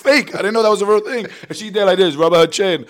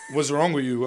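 retold question; 7 semitones lower, 9 dB quieter than usual. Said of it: "She goes".

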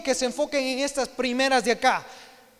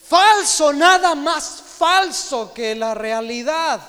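emphatic preaching; 2 semitones higher, 7 dB louder, slower than usual. Real, not acted. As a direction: slam down the false idea. Forceful rebuttal.

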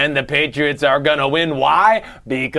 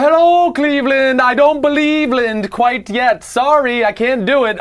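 high taunting voice; 7 semitones higher than usual. Acted.

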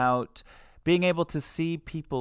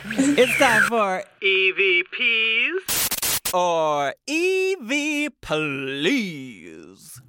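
drawn-out singsong game-show intro; high, loud, slow hammy jingle voice; segment announcement; 6 semitones higher, 3 dB louder, much slower than usual. Pretend. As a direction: announce it big, stretching every letter. Host announces recurring segment title.

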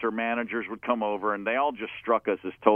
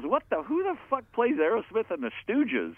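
mock disbelief, pitched much higher; incredulous George; 11 semitones higher than usual. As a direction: imitate his stunned disbelief. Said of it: "And he goes".

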